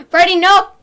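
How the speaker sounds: high, loud terrified yell; panicked scream. Real, not acted. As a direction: scream as if caught. Jump scare in horror game.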